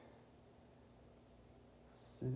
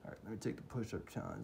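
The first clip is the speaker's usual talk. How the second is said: usual speaking voice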